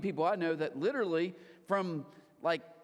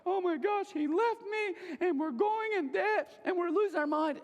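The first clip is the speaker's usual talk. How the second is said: high, drawn-out hammed-up wail; exaggerated high-pitched weepy panic; mock crying; 12 semitones higher, 3 dB louder than usual. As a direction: imitate someone tearfully falling apart. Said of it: "they're crying like".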